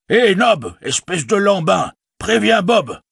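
high-pitched, scolding summons; calling a servant. Acted. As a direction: call out high to hurry a slow servant. Innkeeper calls out to his servant. (appel aigu et impatient au domestique)

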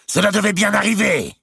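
a high, loud, scornful cry; loud outcry. A narrator's reading. Mao crying out in scorn at Robert's claim. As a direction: cry out over the hall. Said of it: "une voix presque aussi forte s'écrier".

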